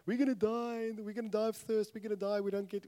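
high, whiny doom chant; mock-whining Israelites. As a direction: parody the endless complaining. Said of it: "it goes like".

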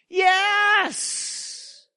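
high, drawn-out joyful yes; jubilant cry. Acted. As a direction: let out a triumphant cheer. Rejoicing in the victory.

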